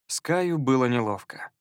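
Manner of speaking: ordinary delivery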